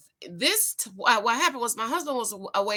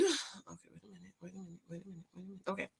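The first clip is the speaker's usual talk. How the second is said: much softer, lower wait a minute under the breath; muttering to self; 4 semitones lower, 17 dB quieter, much faster than usual. Real, not acted. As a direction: mumble while scanning the page. Mutters while finding text.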